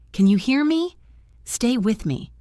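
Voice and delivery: anxious, insistent first-aider; urgent plea. Acted. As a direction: keep a fading patient with you. Urging collapsed man to respond.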